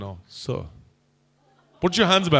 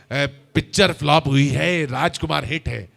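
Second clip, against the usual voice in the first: Raaj Kumar impression; 4 semitones lower than usual. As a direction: boast like a vain film legend. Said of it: "राजकुमार साहब". (राजकुमार की नकल, नीची अकड़ भरी आवाज़)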